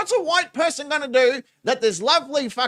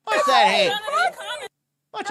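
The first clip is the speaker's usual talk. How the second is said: laughing. Real, not acted. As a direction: crack up while pointing it out. Laughing at a grab.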